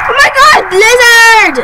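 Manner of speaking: high, loud startled cry; shouting in sudden alarm. Real, not acted. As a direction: caught off guard by the storm. Blizzard hits in the game.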